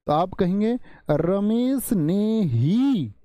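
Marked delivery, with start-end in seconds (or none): 1.1-3.1 रमेश ने ही, slow, ही pressed; stress on ही. Shown as a demonstration. Teacher adding force on ही for class. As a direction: hit ही hard. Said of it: "अब मैं जोर दे रहा हूं".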